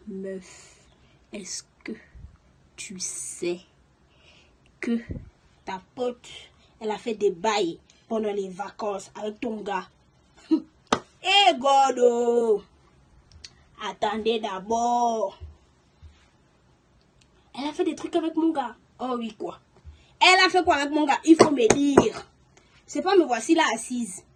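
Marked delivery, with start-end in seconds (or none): 0.1-9.9 low, hushed confiding voice; hushed secret. Retold or imitated. Friend whispering a secret about her best friend, hushed. 11.2-15.4 loud, drawn-out cry of shock; stunned outcry. Real, not acted. Storyteller reacting to the betrayal news, loud slow shock. 20.2-22.3 loud, urgent demand to know; outraged demand. Retold or imitated. Girl pressing the friend for details, loud and outraged.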